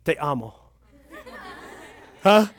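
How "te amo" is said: low, hushed Spanish endearment; tender declaration. Acted. Preacher to pretend Spanish girlfriend, tender. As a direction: play the soft-voiced lover, pitched low. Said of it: "my Spanish girlfriend".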